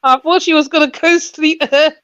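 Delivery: loud, high, amused complaint; laughing. Real, not acted. Mum explains her daughter's interruption to the group, laughing.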